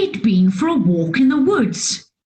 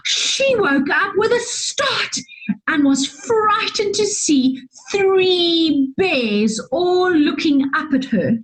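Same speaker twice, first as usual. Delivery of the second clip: ordinary delivery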